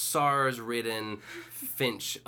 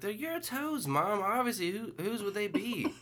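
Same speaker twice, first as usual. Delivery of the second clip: lightly voiced patient daughter, higher; daughter's voice; 10 semitones higher than usual. Daughter reassuring her mother, lightly higher voice. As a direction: soothe mom with a lifted voice. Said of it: "the daughter was like".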